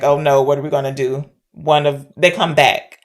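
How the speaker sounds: ordinary talk, nothing marked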